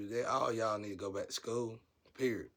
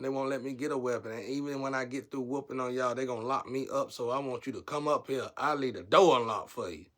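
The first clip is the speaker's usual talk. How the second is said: defiant challenge; 4 semitones higher, 6 dB louder than usual. Retold or imitated. Challenging enemies to come fight.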